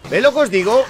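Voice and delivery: loud, high, fed-up told-you-so; exasperated. Real, not acted. Streamer shouting at chat as the horde appears, exasperated. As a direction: shout it like you were right all along. Horde spawns, proving his point.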